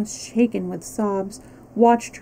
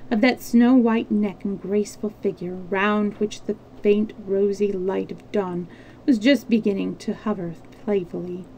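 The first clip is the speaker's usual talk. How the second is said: plain reading voice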